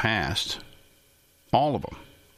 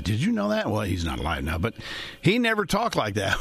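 normal talking voice

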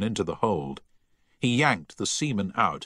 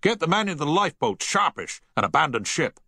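higher, raised captain giving the order; firm naval command; 8 semitones higher than usual. A narrator's reading. Captain orders the crew to the lifeboats, commanding.

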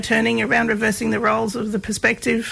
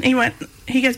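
normal talking voice

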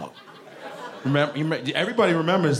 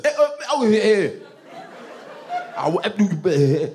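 mock father's wordless whooping noises; wordless angry grunts; 5 semitones higher than usual. Father whooping his children without full sentences, imitated angry grunting. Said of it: "wouldn't even use full sentences".

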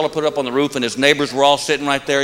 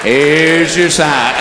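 deadpan punchline; 2 semitones lower, 3 dB louder, much slower than usual. Acted. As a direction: stretch each word to milk the laugh. Catchphrase punchline.

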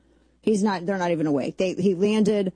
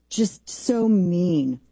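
slow sarcasm; much slower than usual. Pretend. Sarcastic mocking echo.

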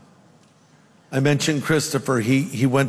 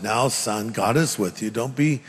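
lower, calming dad voice; reassuring father; 5 semitones lower, 4 dB quieter than usual. Retold or imitated. Father reassures his scared son at bedtime, reassuring. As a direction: quietly soothe a frightened child, acted. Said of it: "And the father said".

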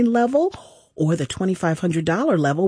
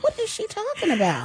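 much higher, incredulous mock question; 13 semitones higher, 3 dB quieter than usual. Cohost voices a relative giving side eye, mock incredulous. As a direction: put on the skeptical relative's voice.

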